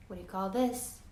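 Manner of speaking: plain reading voice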